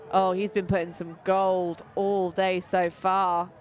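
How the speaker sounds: ordinary delivery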